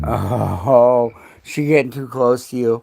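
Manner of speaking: loud coaxing dog-owner voice; loud pet talk. Real, not acted. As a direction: reassure the dog over the noise. Loudly comforting the dog.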